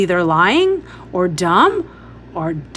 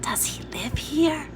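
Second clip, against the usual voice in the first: soft, higher awed whisper; whispered question; 6 semitones higher, 6 dB quieter than usual. A narrator's reading. Jamie awed by the pony, whispering to his sister. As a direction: breathe it in wonder. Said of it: "Jamie whispered".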